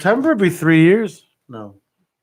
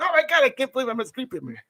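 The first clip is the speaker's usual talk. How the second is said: starstruck gush; 7 semitones higher, 6 dB quieter, faster than usual. Retold or imitated. Imitating starstruck owner.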